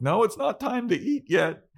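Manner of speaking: very high-pitched nurse voice; mock nurse scolding. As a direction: put on a squeaky, exasperated nurse. Nurse answers the screaming patients.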